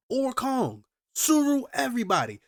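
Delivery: high, loud indignant roll call; name and shame them; heated call-out. Real, not acted. Calling out the world leaders, heated and indignant.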